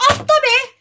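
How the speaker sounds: high, loud frantic yell; desperate shout urging a jump. Real, not acted. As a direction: scream at the screen to jump. Yelling at character to jump.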